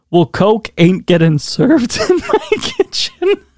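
slow, high, laughing through it. Real, not acted. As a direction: read it cracking up. Reading lyric laughing.

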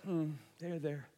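low, soft, unbothered reply; underplay it, totally unfazed; casual, quiet shrug of a voice; playing it cool. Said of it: "he's playing it so cool".

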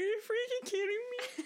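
high, teary laughing disbelief; laughing while crying. Retold or imitated. To Lindsay, retelling the moment the film got her: teary laughing. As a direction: laugh through tears in disbelief.

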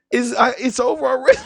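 high-pitched, half-laughing mock whimper; mock despair. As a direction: play the crushed recruit, laughing through it. Acts out believing he was kicked out.

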